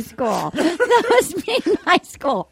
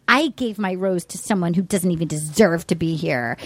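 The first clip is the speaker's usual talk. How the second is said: low, retold disdain; imitated disdain; 10 semitones lower than usual. Retelling her put-down about the rose, low imitated disdain. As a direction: drop the pitch for a quoted put-down. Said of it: "she was like".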